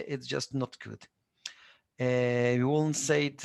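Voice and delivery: usual speaking voice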